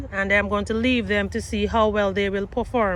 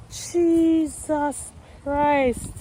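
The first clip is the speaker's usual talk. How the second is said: slow, drawn-out disbelief; exasperated groan; 3 semitones higher, much slower than usual. Real, not acted. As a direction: stretch it out in dismay. Still looking at the damage.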